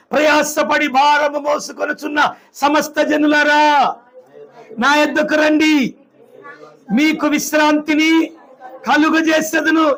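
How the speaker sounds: slow, measured reading of the verse; solemn scripture reading. Acted. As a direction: read the promise slowly, weighing each phrase. (నెమ్మదిగా గంభీరంగా వచనం చదవడం)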